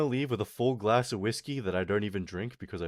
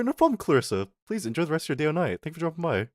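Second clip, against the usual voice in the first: polite clerk voice; 7 semitones higher, 4 dB louder, faster than usual. Acted. Playing shop clerk and visitor.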